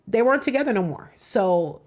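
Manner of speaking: ordinary talk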